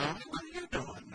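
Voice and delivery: breezy, innocent parent question; light high voice, just curious; friendly sing-song ask. Shown as a demonstration. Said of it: "all you wanted to do as a parent is to know".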